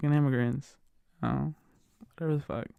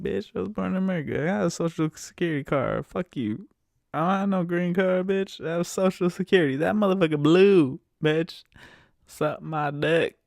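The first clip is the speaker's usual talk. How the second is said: mock rant; 6 semitones higher, 5 dB louder than usual. Retold rant at the chicks.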